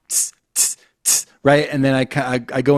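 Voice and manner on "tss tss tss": whispered hi-hat tss; hi-hat beatbox tss. Shown as a demonstration. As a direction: hiss a crisp hi-hat. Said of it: "T S sound".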